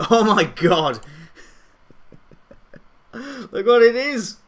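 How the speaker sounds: loud, high burst of real delight; amused shock. Real, not acted. Streamer spots a silly thumbnail, loudly amused and shocked. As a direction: exclaim it, breaking into laughter.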